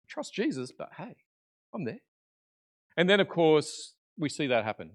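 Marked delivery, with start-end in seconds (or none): 0.1-2.0 high, softened little aside; muttered self-justification. Retold or imitated. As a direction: think aloud, dropping away to almost nothing. Imagining Peter's private reaction.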